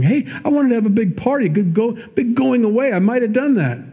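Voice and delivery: high, fast put-on voice of false innocence; mocking a manipulator, high and quick; mock Laban. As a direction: play the sly father-in-law pretending he meant well. Preacher mocks manipulative Laban.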